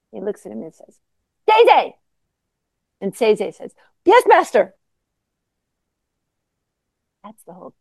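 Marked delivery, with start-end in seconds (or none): 1.5-1.9 a sudden, high, loud call of his name; sharp shout. Acted. Zen teacher calling the monk's name, sudden loud shout. Teacher abruptly calls the monk. 4.0-4.7 high, loud and instant reply; loud answer. Acted. Monk answering his teacher, loud instant reply.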